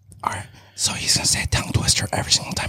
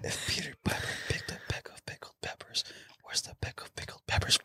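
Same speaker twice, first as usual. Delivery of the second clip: whisper; 6 semitones higher than usual. Real, not acted. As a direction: keep it breathy and hushed. Whispers all through.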